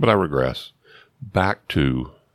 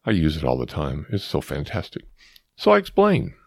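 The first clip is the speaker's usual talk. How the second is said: plain reading voice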